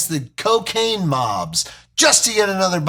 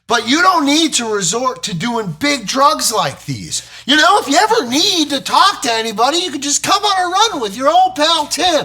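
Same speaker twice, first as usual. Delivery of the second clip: climbing higher and faster, eager buddy pitch; rising excitement; 10 semitones higher, 4 dB louder than usual. Acted. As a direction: pitch it up like a cool pal. Lecture climbs high and fast.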